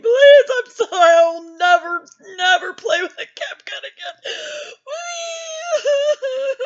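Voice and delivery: high, loud mock-sobbing scream; screaming, crying. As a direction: scream the plea in exaggerated tears.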